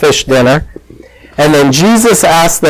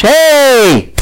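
high, drawn-out mock yell; acted call across the water, no real shouting; 8 semitones higher, slower than usual. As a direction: hail someone far off, pitch raised. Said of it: "somebody yells from the beach".

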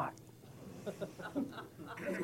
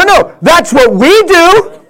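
usual speaking voice

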